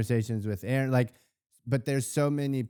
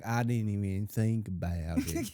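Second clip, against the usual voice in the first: put-on Southern accent; 5 semitones lower than usual. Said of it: "whole show in a Southern accent".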